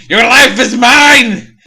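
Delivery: high, loud, slow villain roar; the villain screams his threat at Mac, high and loud; mock villain shout. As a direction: bellow the threat like a hammy cartoon villain.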